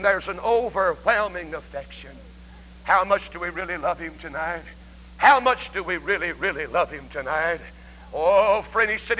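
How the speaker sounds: soft, low, earnest preaching; hushed appeal. Real, not acted. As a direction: drop low and plead with the congregation. Preacher drops to hushed, heartfelt appeal.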